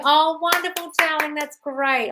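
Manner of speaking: high, gushing congratulation; gushing praise. Acted. Coach acting out praise for affirmations, gushing. Voices lavish praise for herself.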